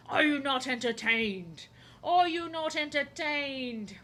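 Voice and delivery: slow, booming arena challenge; lightly voiced Gladiator line; Gladiator quote. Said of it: "Gladiator two".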